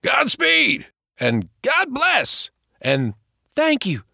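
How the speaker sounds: high farewell calls across the water; calling out. Acted. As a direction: call out to the departing boats. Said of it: "waving and calling out".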